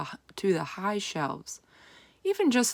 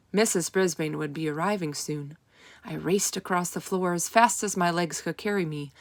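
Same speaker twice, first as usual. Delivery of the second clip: usual speaking voice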